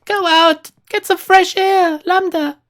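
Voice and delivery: high, drawn-out mock mom voice; slow, high-pitched parody of mom; imitated pleading mother. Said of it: "My mother tried the same".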